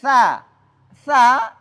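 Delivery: light lisped consonant starting "Sa"; thin tha. Shown as a demonstration. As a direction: keep the vowel of "Sa" thin and open. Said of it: "sehingga bunyinya tipis".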